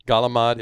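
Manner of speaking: "Galamad" ending on D; US-Italian "Galamad", last syllable "mahd"; American Italian pronunciation. Shown as a demonstration. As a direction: say "Galamad" with no final vowel. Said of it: "is a United States thing".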